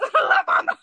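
high, loud, giggly echo; laughing mimicry. Friend echoing her own line, laughing mimicry. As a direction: burst out with a hammed-up repeat.

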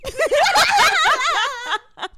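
high burst of laughter; loud high cackle. Real, not acted. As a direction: break character laughing. Laughs breaking character.

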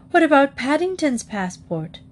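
high, worried outburst falling at the end; alarmed exclamation. A narrator's reading. Missus Bird remembering Paddington has no passport, alarmed. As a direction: burst out with the worry, pitched high. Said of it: "exclaimed Missus Bird".

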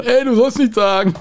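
high-pitched imitation of a shout; imitated yell. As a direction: mimic someone yelling from the background. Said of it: "schrie der andere schon".

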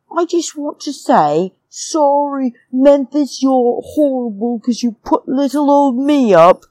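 high-pitched mock self-pity; mocking impersonation. Vlogger mimicking exit speech aimed at Memphis, high mocking voice.

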